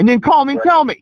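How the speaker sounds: furious they never called; cranked-up complaining; indignant rant. Retold or imitated. Ranting about disconnected service.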